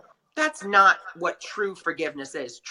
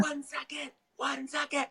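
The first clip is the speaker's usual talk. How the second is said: hoarse whisper; 8 semitones higher, 8 dB quieter than usual. Retold or imitated. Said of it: "he's losing his voice".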